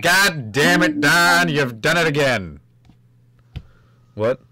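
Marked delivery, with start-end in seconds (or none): 0.0-2.6 louder, higher, slow mock cheer; hammed-up slow shout. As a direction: draw it out like a cheesy ad. Echoing the mock exclamation.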